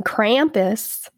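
American "Krampus" with a Southern twang; American accent. Shown as a demonstration. Podcaster refusing the German way, Southern American accent. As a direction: say "Krampus" the American way, short "pus" ending. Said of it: "I'm gonna be American".

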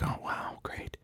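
flat whispered sarcasm; sarcastic whisper. Retold or imitated. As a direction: whisper it, unimpressed. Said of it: "I'm like".

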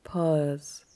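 calm yoga nidra guide, leaving space after each phrase; guided relaxation. Acted.

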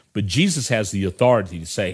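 normal talking voice

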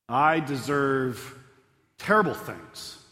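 ordinary delivery

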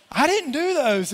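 loud, high, slow mock protest; retold protest. Pastor retelling denial to detective, emphatic protest. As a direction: plead innocence with drawn-out emphasis.

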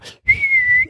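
high, loud whistling wind noise. Shown as a demonstration. Father whistling the wind before blowing on his son's neck.

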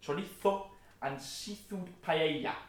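slow, showy Spanish accent with lisped z. Shown as a demonstration. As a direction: roll it out in exaggerated Spanish. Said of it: "You were always very good at Spanish".

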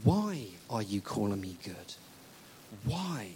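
low, deliberate challenge; measured probing question. Acted. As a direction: ask it calmly and pointedly. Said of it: "What Jesus is saying is".

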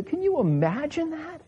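a preacher asking the dads, amazed. Real, not acted. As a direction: marvel at it aloud. Preacher marvels at Abraham's test.

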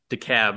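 DeKalb said "dee-CAB"; local pronunciation. Shown as a demonstration. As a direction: end DeKalb just like cab. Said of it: "like why call a cab when you can call decab".

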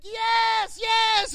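high mock cheer; excited yes. Jesus cheering Peter on, high mock delight. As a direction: squeal a delighted yes twice.

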